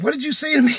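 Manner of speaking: high, loud confrontational shout; angry challenge. Retold or imitated. Driver out of his car confronts man, angry shout. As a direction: demand an answer, shouting.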